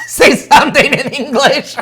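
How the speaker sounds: high, loud, laughing plea; giggling high request. Real, not acted. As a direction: squeal it through giggles.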